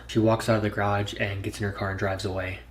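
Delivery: ordinary delivery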